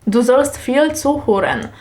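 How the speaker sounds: ordinary talk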